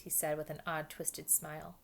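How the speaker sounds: usual speaking voice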